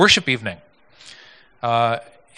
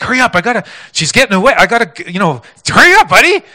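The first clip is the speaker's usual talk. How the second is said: louder and higher, rushed mock shout of a tipsy man; impatient drunk yelling; 8 semitones higher, 8 dB louder, faster than usual. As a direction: hurry him along, half-slurred and pushy. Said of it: "he's kind of slurring".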